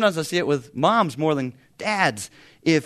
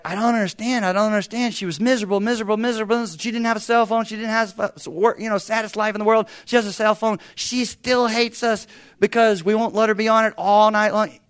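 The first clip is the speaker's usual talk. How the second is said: higher, louder exasperated dad retold; frustrated father; 8 semitones higher, 6 dB louder than usual. Friend on phone about teen daughter, exasperated and raised. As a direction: play the baffled father venting. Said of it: "he was just like".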